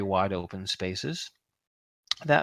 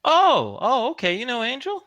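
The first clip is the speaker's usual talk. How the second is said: higher, louder "oh, oh" of surprise; surprised receptionist; 12 semitones higher, 4 dB louder than usual. Acted. Receptionist surprised that the visitor knows Angel, higher. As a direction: perk up at the name Angel, pitched high.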